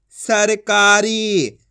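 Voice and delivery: very slow, stretched "सरकारी"; sounds joined slowly. Shown as a demonstration. Teacher blending the letters into a word, very slowly. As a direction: draw out every syllable of "सरकारी". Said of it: "तो मिलके बन जाएगा".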